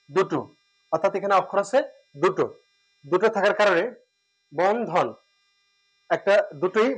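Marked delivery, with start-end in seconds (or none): none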